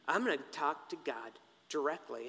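low, soft, determined aside; quiet resolve. Acted. Job resolving to take his case to God, quiet resolve. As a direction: say it low and under the voice.

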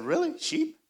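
high-pitched doubtful question; mock incredulous, voice jumping high; skeptical disbelief. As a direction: squeak it out, unimpressed and puzzled. Retells doubting the promised sight.

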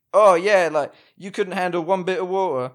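jeering cockney, dropping the t in water. Acted. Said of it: "I don't know why I went all cockney".

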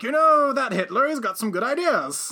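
a hint of a posh king praising Hitler; mock royal voice. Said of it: "the king at the time who was kinda like".

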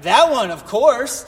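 loud, slow mock approval; playing along with the daughter's pick, loud and slow; hammed-up agreement. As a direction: overplay the eager yes. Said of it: "And I'd be like".

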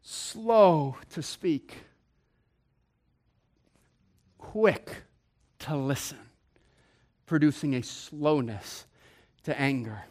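slow soft recital. Shown as a demonstration. Preacher reciting James quietly. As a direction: let each word settle. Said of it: "He says we ought to be".

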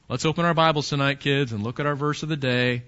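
a touch of dull, dutiful parent; mock boring parent. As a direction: lightly imitate a dreary Bible-time dad.

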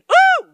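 a sudden falsetto ooh, loud and playful; high squealing ooh. Retold or imitated. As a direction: squeal the ooh like you're about to snap. Road rage cut off.

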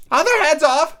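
very high, loud question; shouting Queen. A narrator's reading. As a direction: yell it as an imperious queen. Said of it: "shouted the Queen".

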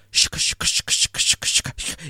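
whispered shaka shaka rhythm; shaka beat imitation. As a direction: tap the rhythm out in breathy syllables. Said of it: "it's just going".

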